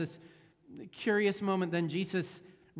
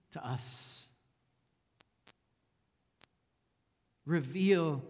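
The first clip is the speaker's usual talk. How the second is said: slow, measured prayer with long pauses; spoken prayer; slower than usual. Real, not acted. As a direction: pray slowly, letting each phrase settle. Leads the congregation in prayer.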